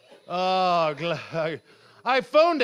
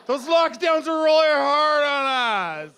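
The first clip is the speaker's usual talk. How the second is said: put-on Southern American accent; 3 semitones higher than usual. Comedian blames lockdown for his voice, Southern American accent. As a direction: stretch it out in mock Southern American. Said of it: "Why is he talking in a Southern American accent?".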